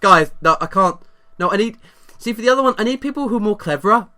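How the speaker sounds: imitating the friend. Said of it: "you started by, going".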